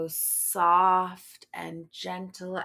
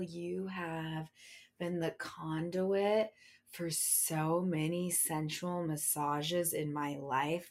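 usual speaking voice

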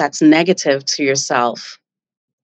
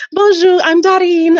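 high, louder French greeting; playful impression, pitched way up; Darine; 13 semitones higher, 5 dB louder than usual. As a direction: sing out a bright entrance, imitating a French woman. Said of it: "she walks in and she's like".

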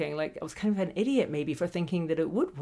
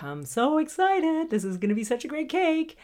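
excited; 10 semitones higher than usual. Pretend. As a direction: sell the thrill of a cake about to bake. Excited about the cake.

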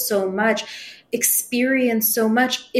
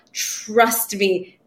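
ordinary talk

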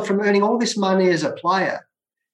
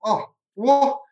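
retold surprise; 6 semitones higher than usual. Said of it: "I said".

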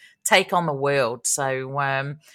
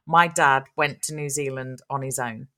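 ordinary talk, nothing marked

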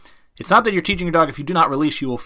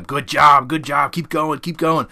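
encouraging praise; slower than usual. Acted. Handler praising a dog to keep it biting, acted encouragement. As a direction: egg the dog on with quick repeated praise. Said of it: "praise them".

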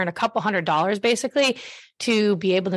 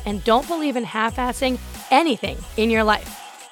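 brassy voice; 3 semitones higher than usual. Acted. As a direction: sell it like a radio host. Podcast intro read over music.

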